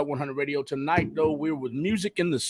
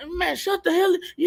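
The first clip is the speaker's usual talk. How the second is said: higher-pitched mock brush-off; mock tough front; 13 semitones higher than usual. Host showing how he hides his melting, mock tough front. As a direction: act unmoved with a put-on protest. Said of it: "I still be".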